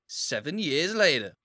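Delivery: slow American title-card voice; mock American film caption, drawn out. Reading a film's time-jump card, slow American accent. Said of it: "why is it in American voice".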